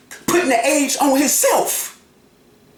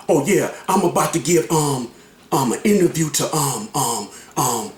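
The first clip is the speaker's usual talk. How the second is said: halting retelling; 7 semitones lower than usual. Retold or imitated. Man retells how the interview was announced, halting imitation. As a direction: imitate a hesitant speaker stumbling over ums. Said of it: "That's how he said it".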